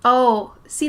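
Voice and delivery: ordinary talk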